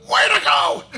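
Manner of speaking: loud high-pitched cheer. Acted. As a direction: yell it out in a shrieky high voice. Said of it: "like, a high-pitched voice go".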